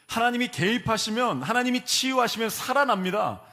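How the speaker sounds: high, loud rising proclamation; full-force preaching. Acted. Preacher proclaiming God turns things around, loud and rising. Climactic declarations of God's power.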